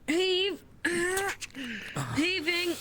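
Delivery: loud, high rhythmic heave; heaving call. Real, not acted. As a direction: call the pull like a tug of war. Hauling a heavy boat together.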